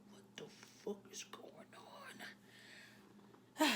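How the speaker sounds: hushed whispered disbelief. Acted. Kazuha sees the unwanted boyfriend arrive, whispering. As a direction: whisper it under your breath in shock.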